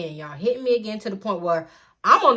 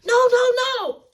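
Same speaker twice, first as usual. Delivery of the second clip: high, loud pleading no; man shouting no; 6 dB louder, slower than usual. Retold or imitated. Alex stopping mom's beating, high loud pleading. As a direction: cry out to stop her. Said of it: "Alex, like".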